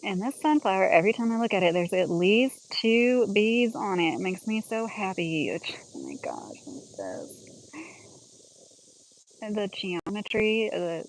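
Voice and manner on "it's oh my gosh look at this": hushed, softer whispered wonder; awed whisper. Real, not acted. Gardener marveling at bees on a sunflower, awed whisper. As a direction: whisper so the bees stay put.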